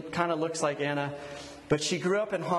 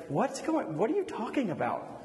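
higher-pitched mock bewilderment; acted confusion; 4 semitones higher than usual. Confused listener to someone bargaining, imitated bewilderment. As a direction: break off and restart, imitating someone baffled.

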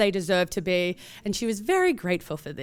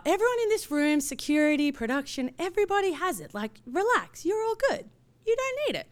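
playful brush-off; 8 semitones higher than usual. Retold or imitated. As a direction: wave it off in a high sing-song. Narrator brushing off [name]'s request.

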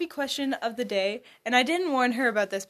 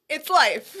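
laughing; 6 semitones higher, 5 dB louder than usual. Real, not acted. Podcast tagline punchline.

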